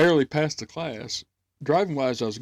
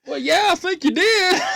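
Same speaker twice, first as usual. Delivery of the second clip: high, laughing sarcastic reply; 14 semitones higher, 4 dB louder than usual. Retold or imitated. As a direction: answer through a laugh, high. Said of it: "And I was like".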